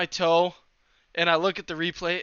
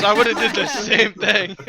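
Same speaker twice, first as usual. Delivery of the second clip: amused buddy reply; 6 semitones higher, 10 dB louder than usual. Retold or imitated. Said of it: "He goes".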